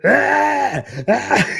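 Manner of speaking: high, loud imitated scream; mock shriek of fright; two short hammed-up screams; freaked-out scream. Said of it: "She was so freaked out".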